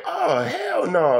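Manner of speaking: high, slow, incredulous "Oh, hell no"; mock outrage. Narrator voices the German Shepherds glaring at him, high and slow in mock outrage. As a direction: voice the dogs' indignant stare. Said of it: "they looked like".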